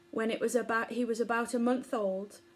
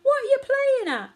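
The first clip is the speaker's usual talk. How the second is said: high, loud squeaky outrage; a mouse blown head over heels protests; indignant cry; 8 dB louder than usual. A narrator's reading. Momo, blown over by the sneeze, cries out in high protest. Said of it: "cried Momo".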